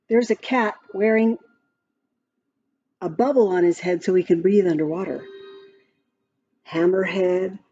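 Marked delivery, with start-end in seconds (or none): none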